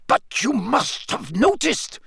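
high-pitched, drawn-out agitation; worked-up, urgent protest; agitated. A narrator's reading. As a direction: plead anxiously, pitch leaping up. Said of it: "began in an agitated voice".

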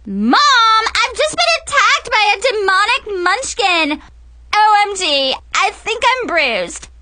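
high, loud dramatic wailing; hysterical teen shriek. Acted. Mackenzie shrieks to Mom about her bruise, high and loud. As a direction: melt down like a spoiled diva. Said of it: "Mackenzie shrieked".

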